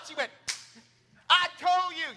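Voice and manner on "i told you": told-you grandma. Retold or imitated. Grandma scolding.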